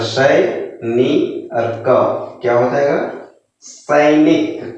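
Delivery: very slow syllable blending into सैनिक; slow sounding out. Shown as a demonstration. Teacher slowly blends नी and क into सैनिक. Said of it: "तोड़ के पढ़ना है".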